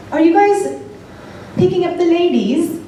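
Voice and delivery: high-pitched fake British chat-up; put-on British accent. Woman pretending to be British to guys at bar, high British accent. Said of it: "pretending to be British".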